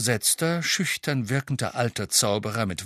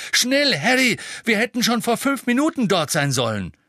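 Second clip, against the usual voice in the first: high, loud urgent call; urgent shout; 11 semitones higher, 5 dB louder than usual. A narrator's reading. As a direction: hurry him along loudly. Weasley urgent, late.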